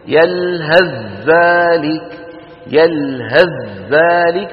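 يلهث ذلك chanted slowly with ث merged into a doubled ذ; merged recitation. Shown as a demonstration. Teacher chants the joined phrase for students, slow. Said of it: "لو وصلنا يلهث بذلك شو بنقول".

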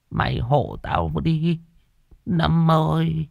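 slow, high, feeble whine; whimpering. A narrator's reading. Frightened old man begs his friend to stand in, whimpering plea. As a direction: beg tearfully with drawn-out words. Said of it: "Lão mếu máo nhăn nhó".

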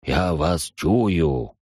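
slow sinister demon whisper; singsong whisper. Acted. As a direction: draw the words out menacingly. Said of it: "Раздался зловещий шёпот".

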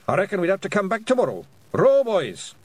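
quick, high Scots, rising to a loud call; Rob Anybody. A narrator's reading. Pictsie leader ordering his rowers, urgent high Scots. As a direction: rattle it off, then call the crew to action.

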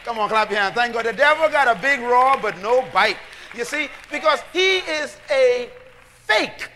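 loud, rising call to the congregation; full-force preaching. Acted. Preacher calling out to his congregation, full-force preaching.

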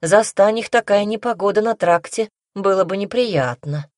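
ordinary delivery

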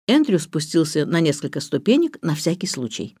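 usual speaking voice

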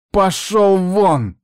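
high, loud, drawn-out order out; furious dismissal. A narrator's reading. Niece orders her uncle out, furious. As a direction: throw him out, slow and forceful. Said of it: "прошипела та".

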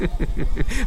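a big mocking burst of laughter; hearty scornful laugh; booming laugh. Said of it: "Er brach in schallendes Gelächter aus".

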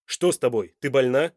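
loud, worried questioning; alarmed. A narrator's reading. Son asks whether his mother is ill, loud and alarmed. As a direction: demand anxiously to know. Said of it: "явно встревоженный".

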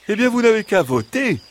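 bright idea, cried in a high voice; exclaiming fox. A narrator's reading. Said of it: "s'exclama le renard".

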